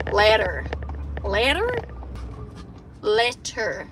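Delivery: American LETTER, trying it out; testing an American pronunciation of letter; attempted American accent. Shown as a demonstration. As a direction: practise saying letter like an American. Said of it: "trying to do the American accent".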